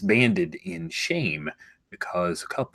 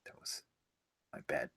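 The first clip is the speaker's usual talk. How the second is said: whisper; 17 dB quieter, faster than usual. Real, not acted. Hushed apology after the bit.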